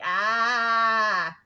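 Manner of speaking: short startled yelp; mock horrified yelp; creeped-out yelp. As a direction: recoil with a sharp cry. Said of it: "you're like".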